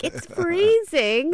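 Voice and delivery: shivering dog voice. Retold or imitated. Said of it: "he's like".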